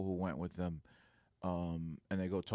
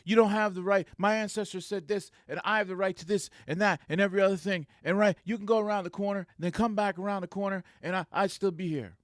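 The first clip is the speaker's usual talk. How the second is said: high, loud imitation of a man insisting on his rights; retold indignant rant; 9 semitones higher, 6 dB louder than usual. Guard defending his rights to the supervisor, imitated indignant rant. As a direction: run the claims together, hot and high.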